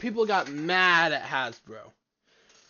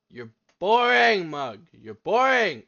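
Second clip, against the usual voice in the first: exasperated heckling; 3 semitones higher, 8 dB louder than usual. Real, not acted. Viewer to a boring video, exasperated heckling. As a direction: shout it at the screen, fed up.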